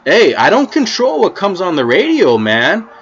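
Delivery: loud, high hey-man pushback; calming him. Retold or imitated. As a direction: raise the voice to cut him off. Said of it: "And I'm like".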